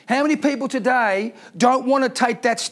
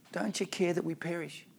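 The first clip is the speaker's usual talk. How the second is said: hushed mock plea; 4 semitones lower, 14 dB quieter than usual. Disciples voiced again, quieter.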